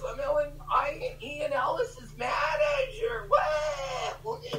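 high, whiny mocking impression; squeaky mock voice. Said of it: "Why does he sound like".

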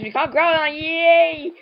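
high, loud happy yay; gleeful victory announcement; celebratory cheer. Real, not acted. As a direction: cheer the win, pitch lifting. Celebrating the catch.

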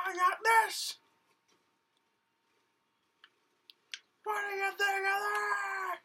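high-pitched fighting yells; high battle voice. Acted. Character fighting enemies, high and yelling. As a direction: shout at the enemy in the squeaky hero voice.

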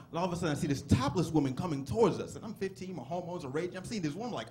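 normal talking voice

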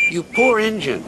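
sympathizing. Acted. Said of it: "sympathized James".

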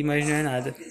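usual speaking voice

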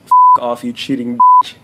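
loud retold insult; quoting angry girlfriend. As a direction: bark it like she did. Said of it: "quote, unquote".

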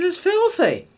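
high, mock-shocked exclamation; acted-out horror; scandalised verdict.

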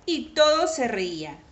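usual speaking voice

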